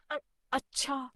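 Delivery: high, slow hesitant crow; sly crow. Acted. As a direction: stall with a sly stammer.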